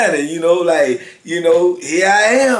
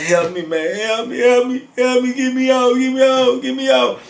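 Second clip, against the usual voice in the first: mock pleading; 8 semitones higher than usual. Bleeding victim begging for help.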